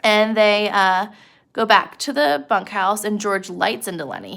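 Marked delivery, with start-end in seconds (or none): none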